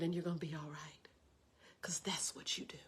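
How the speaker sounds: very soft, low, whispered comfort; very calm. Retold or imitated. Big sister promising it will be all right, calm and whispered. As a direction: reassure them in a calm hush. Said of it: "she was so calm".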